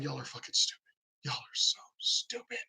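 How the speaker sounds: a contemptuous whisper at the TV; whispered insult. Real, not acted. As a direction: drop to an unvoiced whisper, scornful. Ranting at Democrats on TV.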